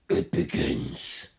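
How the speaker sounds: slow, breathy whisper; ominous whisper. Acted. Carr whispering ominously as the stand transforms. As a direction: breathe the words like a dark omen.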